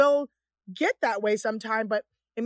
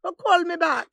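slow, higher Caribbean lilt; mock Caribbean patois, a little higher; put-on Caribbean accent; 6 semitones higher, 4 dB louder than usual. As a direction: play at sounding Caribbean. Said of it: "so you're from the Caribbean now".

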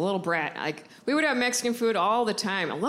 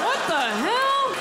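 much higher, slow outraged question; indignant shock; 11 semitones higher, 3 dB louder, slower than usual. Real, not acted. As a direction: drag out the shock. Outraged reaction to grandma.

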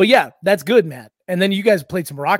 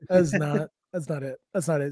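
soft, understated dismissal; matter-of-fact; 2 semitones lower, 4 dB quieter than usual. Retold or imitated. Joking put-down of someone's bit, matter-of-fact. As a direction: dismiss it quietly, as plain fact. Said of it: "Just so matter of fact".

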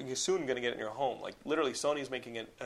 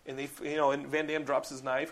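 ordinary talk, nothing marked

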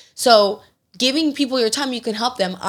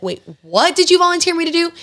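mock-indignant dad, pitch jumping up; louder, high-pitched incredulous question; indignant disbelief; 8 semitones higher, 5 dB louder than usual. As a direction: react as if just told you've been signed up. Dad learns he was volunteered.

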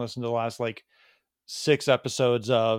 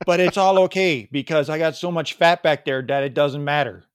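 loud imitated Wisconsin guy; cheerfully oversharing in a Wisconsin accent; 2 semitones higher, 5 dB louder than usual. Man reassuring buddy about hemorrhoids, imitated Wisconsin accent. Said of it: "I can do the Wisconsin accent pretty well".

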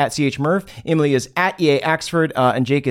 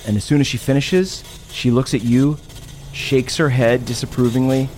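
eerie narration; 4 semitones lower than usual. Acted. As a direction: set a creepy scene, unhurried. Eerie scene setting, lower and slower.

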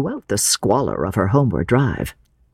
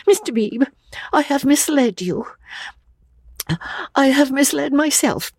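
high, halting, distressed; quavering young woman near tears; tearful confession; 14 semitones higher than usual. A narrator's reading. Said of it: "quavered Lucy".

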